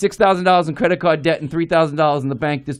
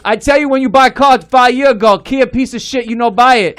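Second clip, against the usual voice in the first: put-on higher, louder Chinese-accented broken English; Chinese accent impression; 4 semitones higher, 5 dB louder than usual. Chinese mechanic scolding him about the Kia, Chinese accent. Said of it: "This is exactly how he talks".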